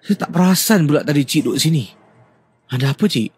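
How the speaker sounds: mildly startled, asking what she wants; startled reply. Shown as a demonstration. Nephew who had not noticed his aunt sitting there, mildly startled.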